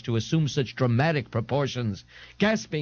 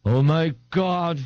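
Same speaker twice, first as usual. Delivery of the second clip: spluttered; 3 semitones higher, 4 dB louder, slower than usual. A narrator's reading. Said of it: "Ignatius spluttered".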